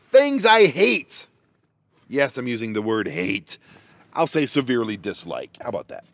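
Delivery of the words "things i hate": high, loud, slow announcer build; dramatic announcement. Acted. Introducing his van complaints list to viewers, mock grand announcement. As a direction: boom out the title like a show host.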